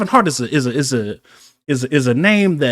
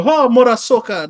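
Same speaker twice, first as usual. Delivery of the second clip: African accent imitation; 7 semitones higher, 4 dB louder than usual. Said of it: "I knew the".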